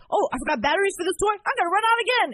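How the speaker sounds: high, fast, loud mock fluster; mock frazzled outburst. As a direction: rush it like a flustered errand-runner. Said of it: "every errand is like".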